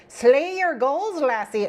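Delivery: piratey mock-Scottish, pitched high; bad Scottish accent. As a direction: attempt Scottish, land nearer pirate. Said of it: "pronounce that with a Scottish accent".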